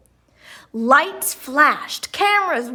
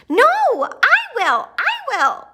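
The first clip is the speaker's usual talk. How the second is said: much higher insistent cry; eager cry; 13 semitones higher, 5 dB louder than usual. A narrator's reading. Greta insists on showing Grampy, crying out. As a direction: insist like a jealous twin. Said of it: "cried Greta".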